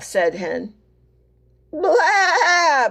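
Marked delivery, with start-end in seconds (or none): 1.7-2.9 high bleating blah; goat bleat. Acted. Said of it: "bleated the Goat".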